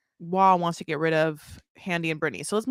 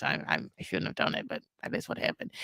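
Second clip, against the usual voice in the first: whisper; 9 dB quieter than usual. Retold or imitated. Brittany confessing a lie aside.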